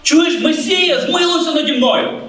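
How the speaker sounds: high, drawn-out plea; crying out. Acted. Blind Bartimaeus crying out to the Messiah, pleading. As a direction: call out for mercy, pitched well up. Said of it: "Вартимей кричав".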